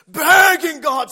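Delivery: high, loud, drawn-out preaching cry; impassioned shout. Retold or imitated. Preacher describing sinners at the altar, shouting. Dramatizing altar pleading, builds to scream.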